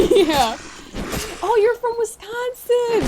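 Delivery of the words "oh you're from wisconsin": high, drawn-out excited exclamation; delighted discovery. Real, not acted. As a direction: exclaim it as a happy realization. Learns viewer is fellow Midwesterner.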